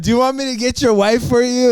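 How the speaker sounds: high, loud frantic imitation of Joe; worried friend. Retelling how Joe panicked, high and loud. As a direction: mimic Joe panicking at the car. Retold scene, Joe's frantic question.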